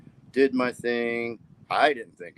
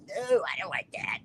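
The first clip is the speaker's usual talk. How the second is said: squeaky refusal. Retold or imitated. Refusing the beer, high put-on voice.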